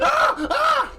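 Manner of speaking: shrieking laugh, high and loud; cackling yelp. Real, not acted. GM laughing scream.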